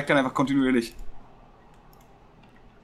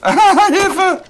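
laughing panicked cry for help; high, loud panicked shout; being chased; 6 dB louder than usual. Real, not acted. Chased by a raptor in game. (lachender Hilferuf auf der Flucht)

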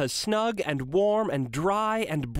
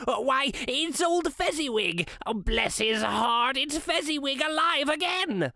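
put-on old Scrooge crying out, much higher; a delighted old man's high cry; great excitement; 8 semitones higher than usual. A narrator's reading. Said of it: "Scrooge cried in great excitement".

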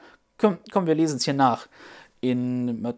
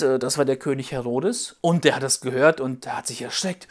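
usual speaking voice

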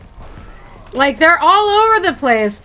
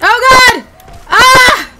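startled yelp; 8 semitones higher, 6 dB louder, slower than usual. Real, not acted. Sudden in-game shock.

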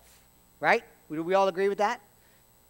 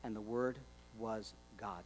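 dropped to a near-hush, much softer; hushed reveal; 10 semitones lower, 16 dB quieter than usual. Shown as a demonstration. Preacher to congregation, hushed and much softer. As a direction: let it land softly, almost a breath. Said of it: "Do you see that?".

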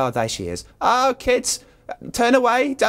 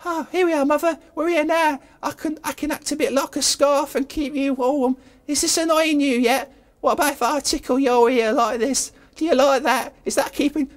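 mamby-pamby voice; 6 semitones higher than usual. Acted. [name] pesters stuck Mother in a mamby-pamby voice. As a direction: play it wimpy and teasing. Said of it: "with a voice that sounds a bit mamby-pamby".

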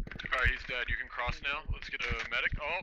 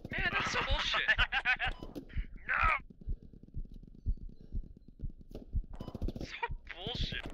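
high, laughing complaint; laughing exasperation. Real, not acted. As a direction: laugh it off in frustration. Dying unfairly, laughing.

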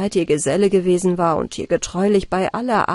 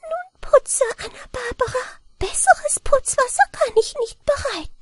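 very high little-man voice, worn out; a tiny helper sighing that he has done his best; weary high gnome voice; tired mannikin. A narrator's reading. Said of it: "setzte sich ganz müde".

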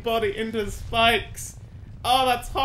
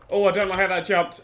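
ordinary talk

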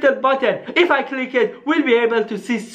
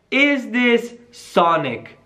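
slow typing voice; much slower than usual. Acted. Saying message aloud while typing.

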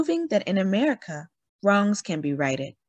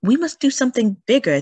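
louder, fast, fired-up resolve; determined push; 5 semitones higher, 6 dB louder, faster than usual. Acted. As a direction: urge everyone to think bigger. Clara resolving on a bigger strike.

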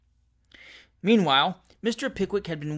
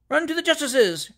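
much higher urgent cry; cried; 9 semitones higher than usual. A narrator's reading. Crowd urging a run to the justice, crying. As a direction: call out for the law. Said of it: "cried a dozen voices".

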